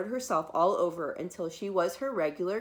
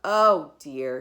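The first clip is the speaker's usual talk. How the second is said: concerned doctor; 5 semitones lower, 6 dB louder than usual. Acted. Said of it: "said the doctor".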